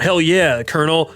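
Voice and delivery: gung-ho soldier. Retold or imitated. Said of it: "no one talks like this".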